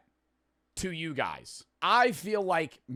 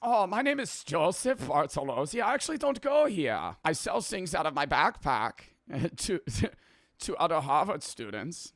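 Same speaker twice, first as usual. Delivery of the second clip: put-on comic German accent, pitched up; 3 semitones higher than usual. Host joining the student introductions as a joke, mock German accent. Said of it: "I'm sorry to any other German people".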